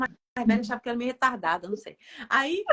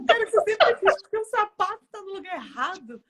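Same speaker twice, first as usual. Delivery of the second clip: high, loud comic outburst; retold complaint, raised and louder; mock exasperation; 7 semitones higher, 6 dB louder than usual. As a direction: burst out in playful exasperation. Retells outburst over misplaced shoe.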